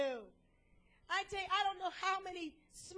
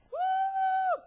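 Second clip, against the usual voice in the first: a sudden high, loud "woo"; high loud whoop; 12 semitones higher, 11 dB louder than usual. Acted. Preacher reacting to the absurd 911 menu, high loud whoop. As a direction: let out a big amazed whoop.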